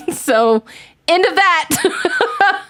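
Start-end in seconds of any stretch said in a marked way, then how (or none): 1.1-2.6 laughing. Real, not acted. Laughing wrap-up of a joke.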